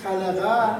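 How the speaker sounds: خلقه said loud with an Arabic qaf; loud Arabic demo. Shown as a demonstration. Teacher models the Quran word to students, loud. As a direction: project خلقه clearly for the class. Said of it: "بخونید".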